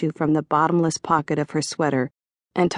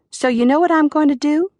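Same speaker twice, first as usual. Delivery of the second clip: higher, louder rhetorical question; John's voice; 11 semitones higher, 5 dB louder than usual. A narrator's reading. Waiter teases the carpool, higher and louder. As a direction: pitch it up like a dare. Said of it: "John asked".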